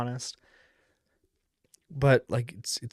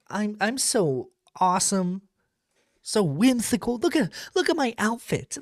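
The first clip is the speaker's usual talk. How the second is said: quirky guy voice; 7 semitones higher than usual. Retold or imitated. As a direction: play up the self-proclaimed quirky dude. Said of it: "You're trying to be, like, a quirky".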